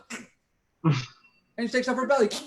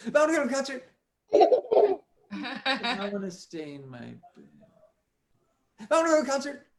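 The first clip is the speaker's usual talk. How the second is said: torn Clara; 8 semitones higher than usual. Acted. Clara alternating eager and sleepy.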